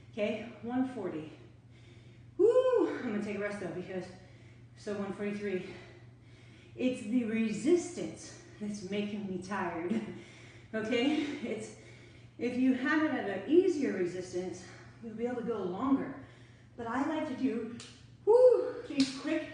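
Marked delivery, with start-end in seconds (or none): 2.4-2.9 a high, loud woo of effort; high loud whoop. Real, not acted. Ending a backwards sprint on the bike, high loud woo. Heart rate jumps. 18.3-19.5 high loud woo, then slow words; excited whoop. Real, not acted. Telling viewers about intense bursts, loud high excited woo.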